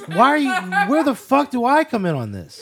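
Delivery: loud, high-pitched bewildered complaint; mock-outraged questioning; exasperated protest. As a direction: demand to know, voice climbing. Player protests being dragged in.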